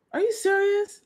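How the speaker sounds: stunned, high-pitched repeat of the question; very high incredulous squeak. Real, not acted. Shocked reaction repeated.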